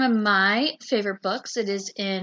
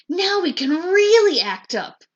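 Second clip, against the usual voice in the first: gleeful; 9 semitones higher, 8 dB louder than usual. A narrator's reading. Kids gleeful at teacher's absence.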